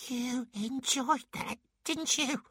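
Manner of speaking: high, slow character voice hissing; hissed reproach. A narrator's reading. Pixie to the dwarf who swallowed him, hissing. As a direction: hiss it in a squeaky high pitch. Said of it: "calmed down sufficiently to hiss".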